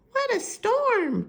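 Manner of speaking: high, chirpy bird voice; twittering wren. Acted. Wren fluttering in from the storm, high chirp. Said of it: "twinters wren".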